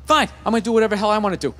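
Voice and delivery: rapid, spiteful inner monologue; fast petty rant. Retold or imitated. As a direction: rattle it off like a sulking kid.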